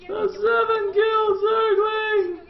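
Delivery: very high, slow squeaky wail; hammed-up lament pushed up into falsetto; mock grieving squeal. Caster mourning the dead Zergling, squealing mock grief.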